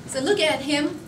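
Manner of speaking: ordinary delivery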